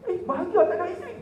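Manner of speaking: high, quick incredulous question; acted disbelief. Acted disbelieving reply to widower.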